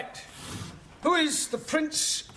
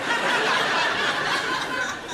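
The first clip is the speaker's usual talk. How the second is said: loud high laugh; burst of laughter; 13 semitones higher, 4 dB louder than usual. Real, not acted.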